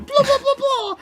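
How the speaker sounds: high squeaky blah blah mockery; mock gibberish. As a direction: squeak out nonsense chatter. Mocking gibberish chatter.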